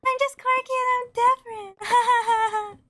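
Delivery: very high, squeaky quirky voice with fake laughter; mock quirky girl. Streamer mocking being quirky and different, high put-on giggly voice. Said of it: "It's like".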